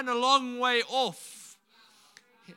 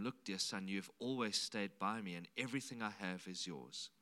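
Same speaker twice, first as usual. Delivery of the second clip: 1 tender father; 14 semitones lower, 10 dB quieter than usual. Retold or imitated. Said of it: "His father said to him".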